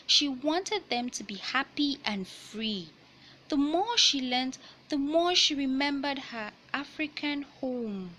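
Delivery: picture book read-aloud voice; reading to children. Acted. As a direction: clear storybook narration.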